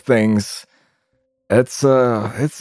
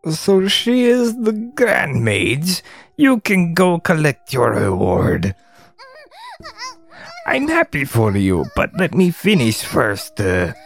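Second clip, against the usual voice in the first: goblin character voice; 5 semitones higher than usual. Acted. Goblin putting off collecting a reward, put-on creature voice. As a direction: play it as a smug goblin. Goblin figures in game read aloud.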